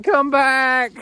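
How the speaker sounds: high, loud mock goat call; voicing the goat. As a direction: play the goat hollering after him. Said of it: "She's like".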